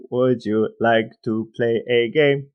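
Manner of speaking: slow, robotic computer voice; computery voice. Retold or imitated. S1 repeating the War Games line, computer voice. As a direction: intone it like a cold machine. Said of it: "that computery voice that you just did".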